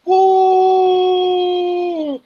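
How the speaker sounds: high whooping cheer; crowd cheer. Acted. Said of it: "everybody's like".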